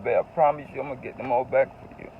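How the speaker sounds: ordinary talk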